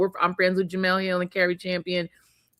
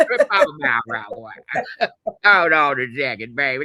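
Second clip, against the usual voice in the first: put-on old lady voice; 7 semitones lower, 4 dB louder than usual. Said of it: "taking your dentures out".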